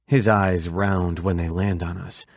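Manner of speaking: usual speaking voice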